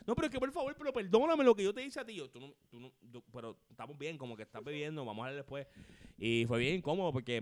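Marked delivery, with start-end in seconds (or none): 0.1-2.2 high, loud tipsy apology; drunk girl pleading. Retold or imitated. Coworker drunk at a party begging forgiveness, high loud pleading. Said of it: "me pararon un party borracha". 2.3-5.7 much softer calming words; quietly calm her down and put her off; hushed. Retold or imitated. Calming a drunk coworker at a party, much softer hush.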